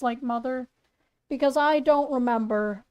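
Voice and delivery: ordinary talk, nothing marked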